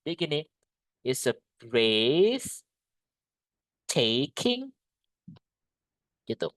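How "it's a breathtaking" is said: breathtaking very slowly, linked; slow model pronunciation. Shown as a demonstration. Teacher modelling breathtaking for the class. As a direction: stretch breathtaking out as one word.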